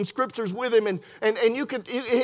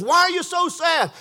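raised, sustained high pitch; high-pitched acted question; 7 semitones higher than usual. Jesus asking the sad disciples, high-pitched questioning. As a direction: lift the whole question up high.

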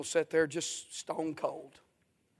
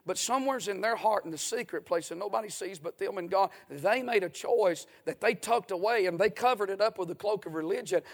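ordinary delivery

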